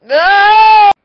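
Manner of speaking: loud high yelp; sudden yelp. Real, not acted. Vlogger breaking off his own sentence with a yelp. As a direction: let out a sharp shout.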